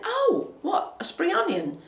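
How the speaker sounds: high, questioning quote; mock surprised query; retold puzzlement. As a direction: act out sudden realisation, pitched right up. Acts out own puzzled question.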